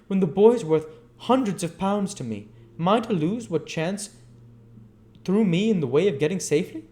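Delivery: loud, high raging tirade; mad with rage. A narrator's reading. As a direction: spill it out in a torrent of wrath. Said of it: "mad with rage".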